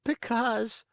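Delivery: put-on very high, hesitant puppet boy; stammering Pinocchio. A narrator's reading. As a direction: falter in a high-pitched little voice. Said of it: "stammering and stuttering".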